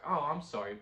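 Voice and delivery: normal talking voice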